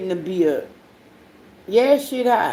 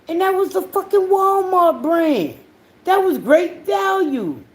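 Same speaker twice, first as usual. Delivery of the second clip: outraged exclamation; 9 semitones higher than usual. Real, not acted. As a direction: cry out at the price in disbelief. Outraged at Walmart price.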